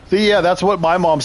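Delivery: laughing. Real, not acted. Laughing at last name joke.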